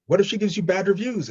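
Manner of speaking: high-pitched imitation of anxious onlookers; worried questioning. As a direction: pitch it up like a concerned bystander. Onlookers worrying in retold exchange.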